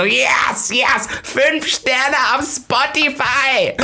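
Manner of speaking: high, slow, starry-eyed fan gushing; excited fan. Retold or imitated. Fan shows the host his five-star podcast rating, high and slow. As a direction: gush like a thrilled fan holding up his phone. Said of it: "Nicht mal so".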